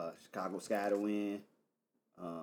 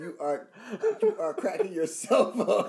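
amused; 13 semitones higher, 9 dB louder than usual. Real, not acted. Teasing a laughing cohost.

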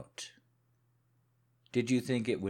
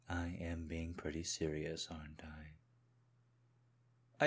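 whispered, slowing to a crawl; menacing whisper; 4 semitones lower, 6 dB quieter, much slower than usual. Acted. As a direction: hiss it softly into her ear. Husband drops to a threat.

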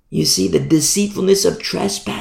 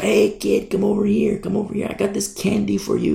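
sly tempter; 6 semitones higher than usual. Acted. Satan luring like a stranger.

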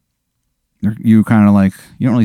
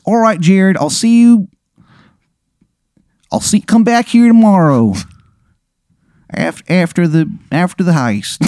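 much higher, hammed-up awful Maryland accent; terrible Maryland accent; 8 semitones higher than usual. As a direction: ham up the worst Maryland accent imaginable. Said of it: "the worst Maryland accent you have ever heard".

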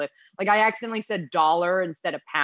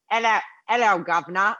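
hammy, half-serious British greeting; badly faked British accent; attempted British accent. Shown as a demonstration. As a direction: have a joking go at British. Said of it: "can you do a British accent?".

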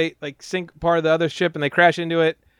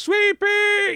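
mock call, much higher. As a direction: call for the lost baby. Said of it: "He goes".

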